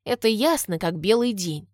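plain reading voice

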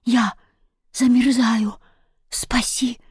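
weak, broken freezing plea; shivering cat. A narrator's reading. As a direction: gasp it in separate bits, fading to whisper. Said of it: "Кузя дрожал от холода".

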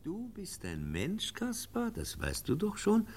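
kindly teacher soothing his pupil; tender reassurance. Acted. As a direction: speak softly as to a frightened child. Professor gently reassures Kaspar.

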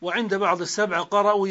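ordinary talk

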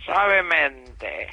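slow, low seductive murmur; voz seductora. Acted. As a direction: drag it out sensually. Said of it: "Es un asco". (seductor y muy lento)

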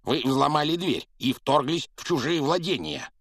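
high, loud indignant protest; outraged. A narrator's reading. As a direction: sputter with outrage. Vernon outraged. (возмущённо, на высокой ноте)

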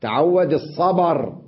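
الصبر as as-sabar, stopping on ر; الصبر with open ba and silent ending; pause with vowel transfer. Shown as a demonstration. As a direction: slowly, move the a of الصبر onto the ب. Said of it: "نقلناها له".

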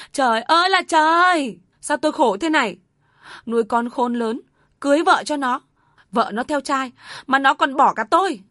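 high, loud wail of self-pity; wailing lament. Acted. Mother wailing that her son abandons her, loud lament. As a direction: wail to the heavens, beating her chest. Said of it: "Bà Tâm gào lên".

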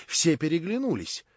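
usual speaking voice

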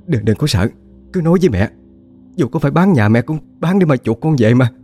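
rising high, desperate questions; a mother panicking for her child; frantic pleading. Acted. (giọng cao, hốt hoảng, van lơn)

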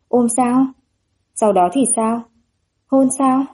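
eager guessing. A narrator's reading. Onlookers wonder whether the two will embrace, eagerly curious. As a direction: ask with mounting anticipation.

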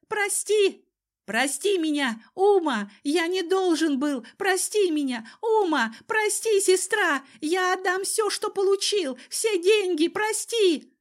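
high, frantic pleading; panicked wailing. A narrator's reading. Cousin begs his sister for forgiveness, wailing in panic. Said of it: "завопил тот на всю округу".

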